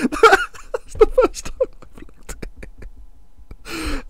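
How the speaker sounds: wheezing whispered laughter; can barely get the words out for laughing; laughing at his own terrible team. Real, not acted. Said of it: "I'm laughing".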